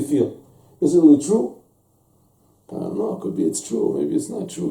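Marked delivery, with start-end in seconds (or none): 2.7-4.7 whispered, unsure musing; hushed wavering whisper; doubtful whisper. Acted. Doubting listener voiced in story.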